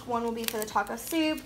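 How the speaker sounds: ordinary talk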